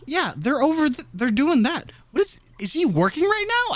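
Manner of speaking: high, bewildered questioning; confused checking Instagram; puzzled surprise. Retold or imitated. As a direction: squint at the screen in disbelief.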